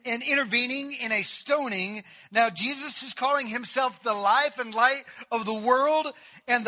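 loud, rising fervent preaching; pulpit-pounding sermon voice; preaching at full force. Acted. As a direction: drive it home to the congregation.

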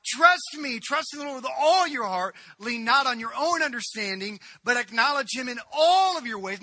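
loud, high, fervent preaching voice; full-force preaching. Acted. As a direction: preach it out to the whole room.

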